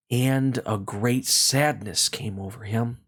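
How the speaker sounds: ordinary delivery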